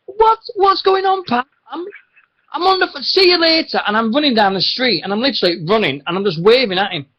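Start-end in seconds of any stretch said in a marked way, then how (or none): 0.1-3.8 very high, loud, broken-off bursts; squeaky-high re-enactment of fobbing someone off; flustered retold protest. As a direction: act out a harried brush-off. Retold flustered escape from pest.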